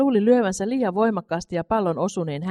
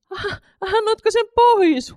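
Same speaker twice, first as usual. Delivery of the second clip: much higher, louder, slow cry of disbelief; astonished cry; 7 dB louder, slower than usual. A narrator's reading. Mother cries out at her son giving away his money, astonished and loud. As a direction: cry out, stunned he gave it away. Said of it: "huusi äiti yhä hämmästyneempänä".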